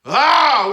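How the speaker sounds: a sudden high loud cry of frustration; loud exasperated "ah"; frustrated outcry. Real, not acted. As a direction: let the anger burst out in one cry. Outburst before venting about the system.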